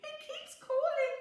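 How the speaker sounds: high, loud, fast frightened mouse voice; a scared little mouse crying for help; high panicky squeaky plea with teary laughs. A narrator's reading. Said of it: "feeling very frightened, he cried".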